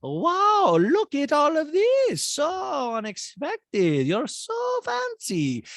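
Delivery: very high, slow Eastern European accent, mock amazed; Diana's accent impression. Host imitating Diana gushing at brunch, very high Bosnian accent. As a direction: gush slowly in a put-on Bosnian accent. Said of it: "And Diana's like".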